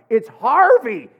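exasperated shout. Retold or imitated. To the confused drive-through girl, a slow exasperated shout. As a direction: finally lose patience and spell it out loud.

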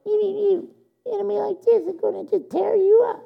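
tiny high-pitched squeak of a voice; puny little enemy. Acted. God warning Joshua about a podunk little enemy, puny squeaky voice. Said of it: "even a little podunk, little".

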